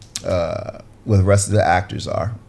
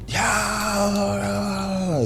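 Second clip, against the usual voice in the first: long, high wailing yell, held back in volume; drawn-out high war cry at half volume; mock battle yell; 12 semitones higher, 5 dB quieter than usual. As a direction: imitate charging in yelling. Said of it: "yelling, swearing".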